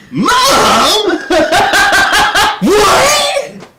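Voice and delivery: high, loud shocked "Mom?" with a laugh; shocked shout. Retold or imitated. Comic turning around to see her mom in the room, shocked. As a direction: yelp it in disbelief.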